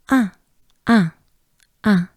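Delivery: "un" as a lone nasal vowel; French nasal vowel. Shown as a demonstration. Teacher drilling the French number one, short nasal vowel. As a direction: repeat "un" separately, no sounded n. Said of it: "you probably know the number one".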